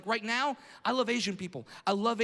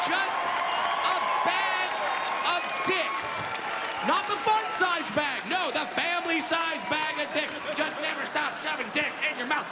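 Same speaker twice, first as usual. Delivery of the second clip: mocking shout; 7 semitones higher, 3 dB louder than usual. Real, not acted. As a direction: drag out just a bag, then yell. Crowd insult escalating.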